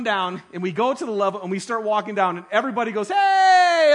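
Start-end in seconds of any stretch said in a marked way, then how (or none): 3.1-3.9 loud jeering "Hey!" from the crowd; sudden mocking yell, much higher; mocking shout. Crowd mocking them at game.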